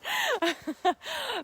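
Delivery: bright delighted laugh; high laugh. Real, not acted. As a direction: burst out laughing. Laughing at Muppets memory.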